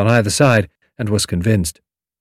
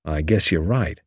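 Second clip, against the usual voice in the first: low, soft, solemn reply; 4 semitones lower, 5 dB quieter than usual. A narrator's reading. Julius answering Tommy, solemn and quiet. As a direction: agree gravely, quietly. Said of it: "replied solemnly".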